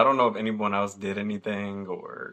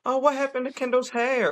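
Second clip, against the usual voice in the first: high-pitched mock teacher voice; sing-song high voice of surprise; concerned teacher; 14 semitones higher than usual. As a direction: imitate a teacher's startled question. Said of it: "one of the teachers come by".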